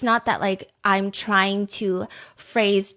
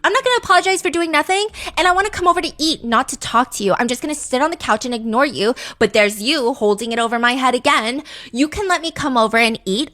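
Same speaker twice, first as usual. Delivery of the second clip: furious rant; 3 semitones higher, 4 dB louder, faster than usual. Acted. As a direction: rattle it off in a rage. Girlfriend escalating the fight.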